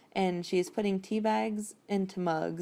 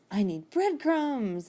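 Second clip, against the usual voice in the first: slow, higher mock cry of discovery; imitated eureka exclamation, pitched up; eureka moment; 6 semitones higher, much slower than usual. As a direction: draw it out like a sudden aha. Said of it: "aha, eureka moment about bread".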